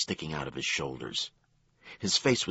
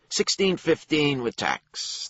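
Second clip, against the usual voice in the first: high, slow clerk voice; 11 semitones higher, 5 dB louder, slower than usual. A narrator's reading. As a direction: read out the price slowly.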